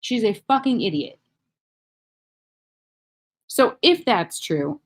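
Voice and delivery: usual speaking voice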